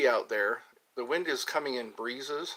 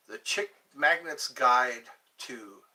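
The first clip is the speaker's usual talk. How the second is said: ordinary talk, nothing marked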